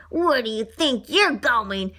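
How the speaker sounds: usual speaking voice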